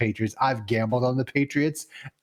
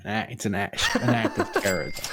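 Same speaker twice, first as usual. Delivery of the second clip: laughing; 4 semitones lower, faster than usual. Real, not acted. Repeats line while laughing.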